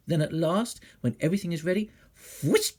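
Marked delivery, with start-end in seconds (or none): 2.4-2.7 high, loud burst; sudden whoosh. A narrator's reading. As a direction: make them jump. Witch swoops on child.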